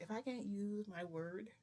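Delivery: usual speaking voice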